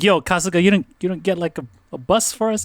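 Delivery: high-pitched mock protest; retold incredulous complaint. Members asking Kasuga about a bus, mock incredulous. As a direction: imitate members griping in disbelief.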